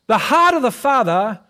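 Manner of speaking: ordinary delivery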